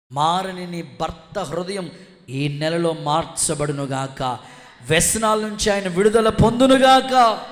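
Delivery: loud prayer building to a shout; fervent declared blessing. Real, not acted. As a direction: pronounce deliverance over the listener, building in force. Preacher pronounces a blessing. (ఆవేశంగా దీవిస్తూ)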